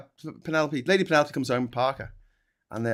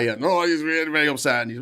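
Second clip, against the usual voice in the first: ordinary talk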